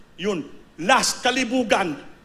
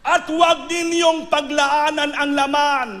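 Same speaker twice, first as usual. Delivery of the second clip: slow, higher, louder stress on "huwag"; preaching warning; 8 semitones higher, 5 dB louder, slower than usual. Acted. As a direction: thunder the warning from the pulpit. (malakas at mabagal na babala)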